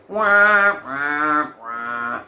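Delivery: mock sad-trombone wah, stepping down. Storyteller undercutting the good news, comic sad trombone.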